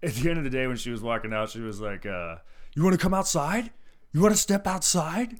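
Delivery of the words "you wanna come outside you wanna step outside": loud, high imitation of a drunk challenger; hammed-up belligerent dare; mock fight challenge. Retold drunk woman's challenge to fight.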